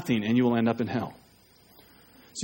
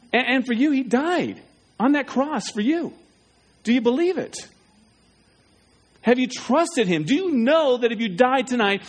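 higher, pressing altar call; preaching appeal; 6 semitones higher than usual. Acted. Pastor pressing the congregation to decide, higher pitch. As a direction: drive the questions home to the crowd.

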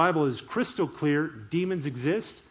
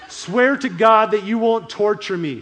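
loud, higher acted shout; demon's shout; 4 semitones higher, 8 dB louder than usual. Possessed man begging Jesus not to torture him, shouting. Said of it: "He shouted at the top of his voice".

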